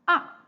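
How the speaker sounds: short "ah" falling from high to low; fourth tone. Shown as a demonstration. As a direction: let "ah" drop steeply. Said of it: "all these four main tones".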